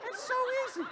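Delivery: light breathy falsetto sigh; high falsetto. Shown as a demonstration. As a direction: float it up high and easy. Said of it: "high falsetto".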